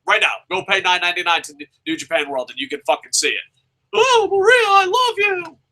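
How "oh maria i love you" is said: much higher swooning mock voice; mock lovesick. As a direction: ham up a lovesick suitor. Mocking the storyline's lovesick line.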